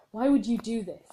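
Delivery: hammed-up story bellow; mock angry bellow. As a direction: hurl it at him in rage. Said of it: "I bellowed in rage".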